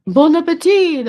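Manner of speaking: high, loud, sing-song French announcer bit; imitating game announcer. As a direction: mimic the game's cheery French voice. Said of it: "it does this thing in French".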